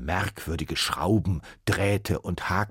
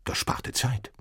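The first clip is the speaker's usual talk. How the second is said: whisper; 5 semitones higher, 3 dB quieter than usual. A narrator's reading. Narrator whispers aside.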